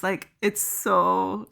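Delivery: ordinary talk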